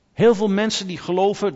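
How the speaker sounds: normal talking voice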